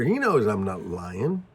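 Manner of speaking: ordinary talk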